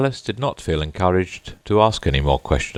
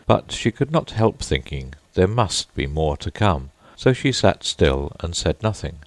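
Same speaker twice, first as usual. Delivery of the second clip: usual speaking voice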